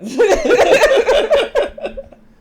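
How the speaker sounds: big real laugh, high and loud; loud burst of laughter. Real, not acted. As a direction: laugh hard at her own slip. Laughs at eyelid mistake.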